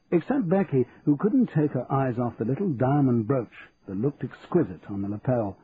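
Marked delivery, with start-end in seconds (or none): none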